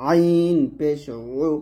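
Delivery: "আইন পেশ উ" held, উ squeezed in mid-throat; ayn with pesh. Shown as a demonstration. Teacher repeating it for learners, "আইন পেশ উ" pressed mid-throat. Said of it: "এরকমভাবে সুন্দর করে".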